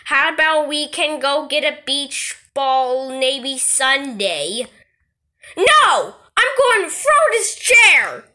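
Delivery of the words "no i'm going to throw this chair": higher, faster threatening shout; furious tantrum. Acted. Kid threatens mom with a chair, furious shout. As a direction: rage like a kid about to break things.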